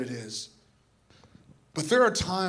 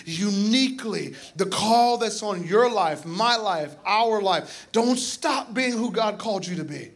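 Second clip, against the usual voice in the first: raised, emphatic preaching; impassioned exhortation; 5 semitones higher, 3 dB louder than usual. Retold or imitated. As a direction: urge the crowd on with rising fervour. Preaches the woman's charge at full force.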